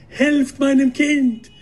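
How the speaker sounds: high, drawn-out cry for help; a mother crying out hysterically. A narrator's reading. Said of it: "schrie die Mutter, hysterisch".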